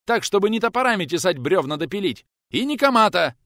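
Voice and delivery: high, loud furious rant; shouting. A narrator's reading. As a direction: bellow every claim. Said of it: "тот вдруг проорал в ответ".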